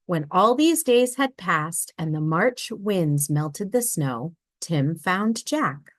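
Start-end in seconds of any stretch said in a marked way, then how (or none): none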